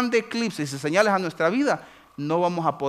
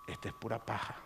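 much lower, much softer verdict; dry dismissal; 6 semitones lower, 15 dB quieter, faster than usual. Retold or imitated. God judging the believer's record, low and soft. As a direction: say it flat and low.

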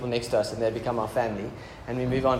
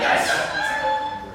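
very high triumphant squeal; excited yelp. Real, not acted. Pastor cheering at a cockroach mid-sermon, high squealed yes. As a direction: shriek it out in triumph.

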